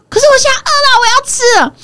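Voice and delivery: put-on high, loud kid insisting; demanding child. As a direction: stamp your foot like a hungry boy. Mother voices demanding son.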